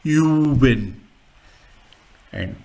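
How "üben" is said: Juben with a long first vowel, falling; long U umlaut. Shown as a demonstration. Teacher giving the long umlaut example, drawn-out vowel. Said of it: "long umlaut".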